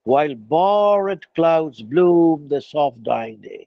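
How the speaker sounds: "borrowed" given both full syllables, slow and low; stress on borrowed. Shown as a demonstration. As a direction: sound every syllable of "borrowed" for the metre. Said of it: "borrowed বলতে হবে". ("borrowed" পুরো উচ্চারণে ধীরে আবৃত্তি)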